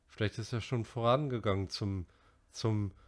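plain reading voice

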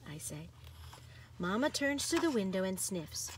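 ordinary delivery

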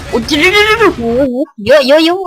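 high startled cry at the crowd of enemies; surprised gamer yelp. Real, not acted. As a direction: caught off guard, jump up in pitch.

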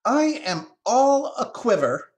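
high, loud, dramatic squirrel; quivering squirrel. Acted. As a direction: protest in a high voice that you're all aquiver. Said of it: "in a gesture of assurance".

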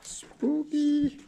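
high, playful spooky voice; teasing spooky singsong; mock spooky. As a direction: sing it out like a ghost story. Reacting to eerie abandoned building.

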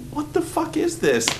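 incredulous squeak. Retold or imitated. Stunned audience at the ending, in a high, incredulous squeak. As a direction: squeak it out in disbelief.

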